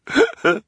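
shrill mocking cackle; high cackle. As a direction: cackle cruelly.

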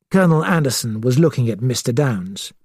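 usual speaking voice

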